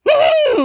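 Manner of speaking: loud, high musing hum; high hmm. Acted. Doctor ponders.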